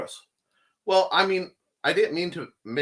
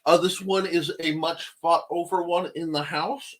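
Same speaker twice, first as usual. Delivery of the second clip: ordinary delivery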